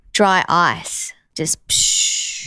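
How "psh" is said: imitated dry-ice hiss. Shown as a demonstration. Imitates dry ice jets on stage.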